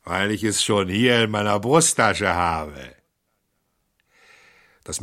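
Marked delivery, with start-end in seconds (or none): 0.0-3.0 louder and louder, crowing in triumph; gloating shout. Acted. The thief taunts the little priest, loud gloating shout. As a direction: crow it at him, rising. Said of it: "rief er".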